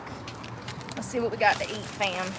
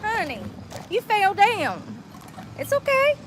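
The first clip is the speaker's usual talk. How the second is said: mock comforting; 11 semitones higher than usual. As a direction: coo at it like a toddler who tripped. Comforting the fallen bag.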